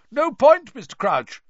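calling after him. A narrator's reading. Diggory calls after Crouch as he strides off, loudly. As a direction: throw it after a man striding away. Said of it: "called after him".

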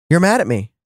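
mildly puzzled echo of an accusation; taken aback. Retold or imitated. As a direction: repeat it back in mild disbelief. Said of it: "And I was like".

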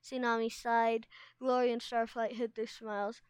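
plain reading voice

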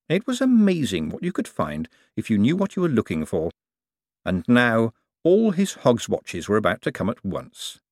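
usual speaking voice

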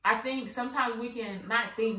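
ordinary delivery

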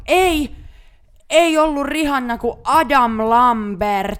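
high, loud burst of sudden recall; excited realization. Real, not acted. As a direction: blurt the correction, then stretch out the name. Suddenly remembers the real first concert. (innostunut oivallus, kovaa ja korkealta)

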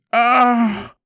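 loud, high agonised scream; long open-throated cry of agony; cry of pain. Acted. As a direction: scream out as the lash hits. Cries out under the lash.

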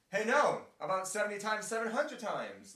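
playful loud correction; mock loud exclaim. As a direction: blurt it out, waving the limit away. Jesus voiced loudly in retelling.

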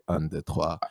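soft and low, half joking; mock seductive murmur. Mock murmur asking a stranger for directions in France. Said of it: "stop someone and say".

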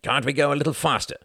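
demanding. A narrator's reading. Quentin to the cab driver, rapping on the window, demanding. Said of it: "demanded Quentin".

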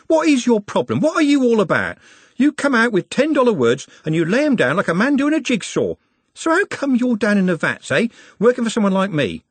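high-pitched heated interrogation; exasperated. A narrator's reading. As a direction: grill him, thoroughly fed up. Red-faced Trev confronts Nut.

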